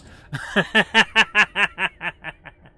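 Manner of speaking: loud, rapid real laugh; hearty laugh. Real, not acted. Gamer amused at killing everything, hearty laugh. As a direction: burst out laughing, long and loud.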